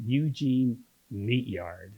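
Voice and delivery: slow, drawn-out relish of the name; savouring the name. Real, not acted. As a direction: linger over each part of the name.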